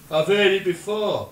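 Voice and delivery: very loud yell back, retold; shouted retort. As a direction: holler back while flying past. Flying fat man shouts back.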